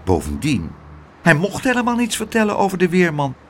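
ordinary delivery, nothing marked

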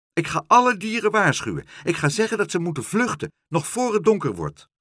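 high, loud, urgent warning; crying out. A narrator's reading. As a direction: rush it out, pitched high. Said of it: "riep Dolly".

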